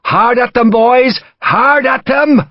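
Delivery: high, slow, roaring Irish battle cry; yelled battle cry. Acted. Irish major launching the charge, full yell. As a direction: let the war cry ring out. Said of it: "Major Goff yelled".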